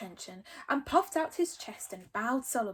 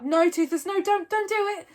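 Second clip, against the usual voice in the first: pleading; 8 semitones higher, 7 dB louder than usual. A narrator's reading. Hiccup pleading with dragon.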